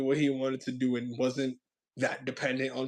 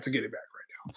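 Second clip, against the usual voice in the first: whisper; 4 semitones lower, 10 dB quieter than usual. Real, not acted. Aside after a plain remark.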